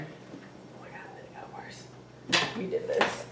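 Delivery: hushed, breathy whisper of dismay. Real, not acted. Friend whispering in horror at the marker stain. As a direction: whisper it like you're horrified.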